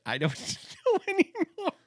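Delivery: laughing it out, voice jumping high; giving up in laughter; high giggly I don't know. Real, not acted. Laughing at own confusion.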